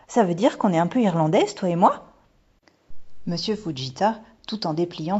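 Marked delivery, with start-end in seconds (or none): 0.1-2.1 put-on louder little-girl question, rising at the end; excited question. A narrator's reading. Daughter asks mother if they are Irish, excited. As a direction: ask it with eager wonder, lifting on the last word.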